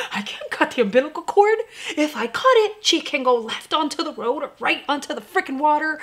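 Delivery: high, frantic protest to her husband; panicky mom protest. Retold or imitated. Mom to husband, refusing to let go, high and panicky. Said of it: "I was like, "I'm mom".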